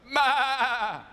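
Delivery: loud, high imitated baa; long high "maa"; sheep bleat. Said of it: "The sheep on my side goes".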